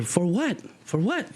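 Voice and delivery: high, indignant acted demand; incredulous challenge. Teacher challenging a cop's arrest, incredulous high-pitched demand. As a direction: throw the question back with pitch jumping up.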